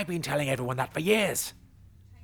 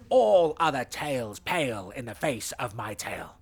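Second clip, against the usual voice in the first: ordinary delivery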